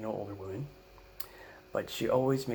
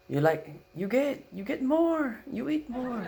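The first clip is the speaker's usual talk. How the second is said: put-on very high pushy Filipino-accented offer; grandma's Filipino accent; 14 semitones higher, 5 dB louder than usual. Grandma pushing seconds on the full grandson, Filipino accent. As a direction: urge more food like a Filipino grandma. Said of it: "she goes".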